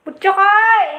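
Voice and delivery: loud call across the room. Real, not acted. Owner calling out to the pet Butchokoy, loud call. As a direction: call the pet sharply by name.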